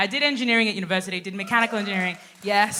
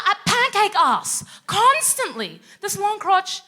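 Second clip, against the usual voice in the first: comic complaint; 9 semitones higher, 4 dB louder, slower than usual. Retold or imitated. Complaint to boss.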